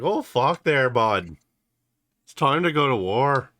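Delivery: attempted Letterkenny Canadian accent; Shoresy. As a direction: belt it out in a rural Canadian accent. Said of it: "the accent that Shoresy did in Letterkenny".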